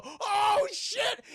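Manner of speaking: high, stretched-out shout; acted panic. Family sees stepfather has turned zombie, panicked shout. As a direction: cry out in hammed-up horror.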